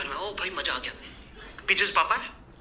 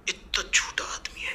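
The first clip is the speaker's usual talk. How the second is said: whispered aside; 8 dB quieter, slower than usual. Real, not acted. Aside about a lying man.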